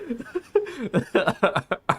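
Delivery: high burst of laughter; big laugh. Real, not acted. Son laughing at his mom on a call, big laugh.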